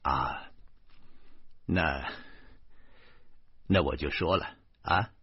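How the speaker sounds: soft, halting, low-key doctor voice; hesitant doctor. A narrator's reading. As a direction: hem and haw before the bad news. Doctor hesitantly breaks bad news. (吞吞吐吐、低声说出坏消息的医生)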